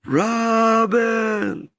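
shouted call. Shown as a demonstration. Showing the Lord the call, high and shouted. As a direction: belt the name out as if across a field. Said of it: "show me".

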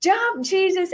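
put-on high, bright kids calling out encouragement; excited children cheering. Children cheering Jesus on from the stands, high and excited. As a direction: cheer him on like a crowd of kids. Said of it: "They're like".

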